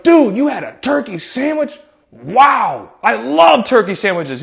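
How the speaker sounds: high, drawn-out wowed reaction; mock amazement. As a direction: gush with hammed-up enthusiasm. Jokey amazement at child's lunch.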